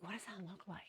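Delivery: hushed, voiceless whisper to herself. Retold or imitated. Measuring a cup, she whispers her confusion. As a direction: breathe the question out with no voice. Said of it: "I'm like".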